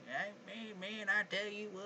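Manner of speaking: a friend mocking her accent, hammed-up American voice. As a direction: imitate a friend hamming up an American twang. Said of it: "he was just mocking it".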